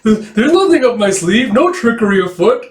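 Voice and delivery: high, loud nervous protesting; fake innocent voice. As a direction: protest way too much. Unconvincing innocent face.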